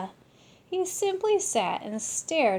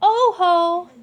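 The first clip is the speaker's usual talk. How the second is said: high, loud holler; 8 semitones higher, 12 dB louder than usual. Acted. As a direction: answer the count loudly. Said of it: "the Martians hollered".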